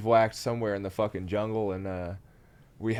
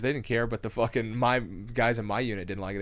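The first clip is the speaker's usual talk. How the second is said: ordinary talk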